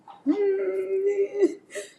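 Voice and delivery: high tearful laugh; a laugh that breaks into tears; laughing cry. Real, not acted. Daughter laughing tearfully remembering her mother.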